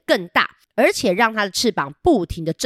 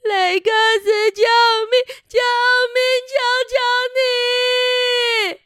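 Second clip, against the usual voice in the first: much higher, shrieking cry for help. Acted. Buster screaming to Rex for help. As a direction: scream for rescue while being carried off. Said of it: "巴斯特大声的喊说".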